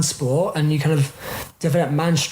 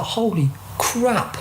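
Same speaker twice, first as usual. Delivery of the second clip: slow, higher-pitched retold astonishment; stunned disbelief; 5 semitones higher, much slower than usual. Teenage lifter reacting to the huge target weight, stunned. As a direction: draw it out in mock amazement.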